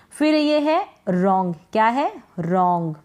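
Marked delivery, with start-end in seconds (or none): none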